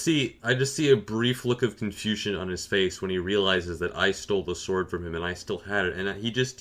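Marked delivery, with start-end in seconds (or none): none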